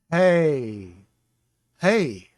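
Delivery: hey pushed out loud from the back of the throat; breathy throat hey. Shown as a demonstration. Teacher demonstrates the guttural hey letter, loud breathy throat sound. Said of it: "uses nothing but the back of the throat".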